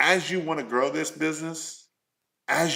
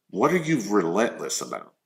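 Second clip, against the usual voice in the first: ordinary talk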